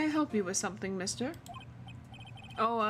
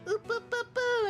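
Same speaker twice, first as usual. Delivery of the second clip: Monokuma voice; 12 semitones higher than usual. Shown as a demonstration. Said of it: "the Monokuma voice".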